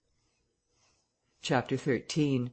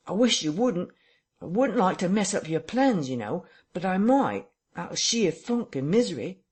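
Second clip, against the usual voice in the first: frightened Marvel; 5 semitones higher than usual. A narrator's reading. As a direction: whimper out of sheer funk. Marvel voiced in story.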